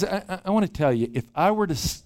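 ordinary delivery

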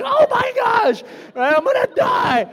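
high-pitched panicked shout, hammed-up; mock freak-out. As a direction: act out screaming inner panic. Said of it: "I'm, like, freaking out".

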